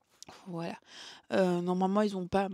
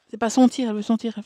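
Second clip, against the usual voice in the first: loud exclamation; 11 dB louder, faster than usual. Real, not acted. Sudden loud outburst to baby.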